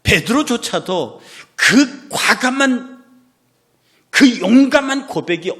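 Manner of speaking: loud, high, slow preaching; full-force preaching. Real, not acted. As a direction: bellow it from the pulpit.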